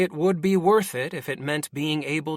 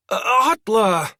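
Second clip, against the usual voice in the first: high, loud, flustered stammer; 8 semitones higher, 7 dB louder than usual. Acted. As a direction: stammer out her name anxiously. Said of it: "Fol stammered".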